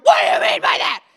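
sudden high, loud, fast snap; mock outburst shout. Burned-out pastor snapping at a harmless remark, mock shout. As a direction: blow up over nothing.

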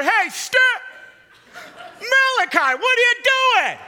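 high, loud re-enacted yell; alarmed parent shout. Dad calls out to his son in the sink, alarmed shout. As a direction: holler at a child in alarm.

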